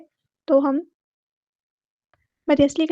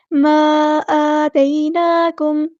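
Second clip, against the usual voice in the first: slow, drawn-out recitation; slow Quranic recitation; 4 dB louder, much slower than usual. Shown as a demonstration. Teacher reciting verse words slowly for students. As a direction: stretch every vowel as in tajweed.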